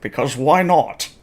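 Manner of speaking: playful put-on Scottish accent; Sean Connery accent. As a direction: lean lightly into a Scottish accent. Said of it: "she is now Sean Connery".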